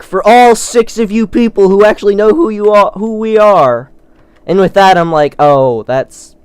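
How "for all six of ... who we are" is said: lightly voiced stage announcer, higher and louder; quoting the band on stage. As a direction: call it out like a frontman to the crowd. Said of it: "they got up there, like".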